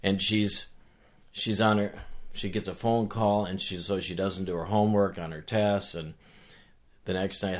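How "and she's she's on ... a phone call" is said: ordinary delivery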